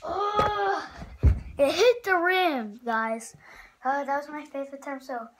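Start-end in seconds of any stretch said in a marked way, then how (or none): 0.0-2.8 loud, high groan of annoyance; real frustration. Real, not acted. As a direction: burst out, exasperated by a near miss. Frustrated at missed shot.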